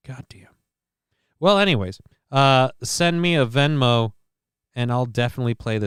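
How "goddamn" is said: whispered goddamn. Real, not acted. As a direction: breathe it out as a whisper. Repeat dropped to a whisper.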